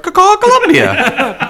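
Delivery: high, slow laughing stretch; laughing drawl. Real, not acted. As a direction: drag it out through laughter. Laughing at the town name.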